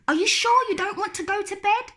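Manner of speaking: high, quick teasing brother; lightly voiced teasing brother. Brother teasing Lola about bed, quick. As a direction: tease her playfully.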